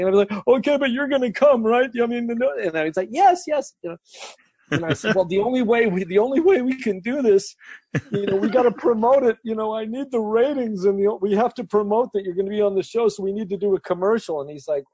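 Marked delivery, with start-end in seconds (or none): 0.6-2.5 high, anxious mock pleading; desperate neediness. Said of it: "kind of at wit's end". 3.1-3.7 very high, needy mock agreement; desperate eagerness. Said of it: "kind of at wit's end". 5.2-14.4 high, strained mock pleading; desperate persuading. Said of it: "kind of at wit's end".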